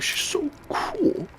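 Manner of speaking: very high, slow whispered gush; a starstruck, whispered squeal. Retold or imitated. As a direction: breathe it out in slow high awe. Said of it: "I'm all like".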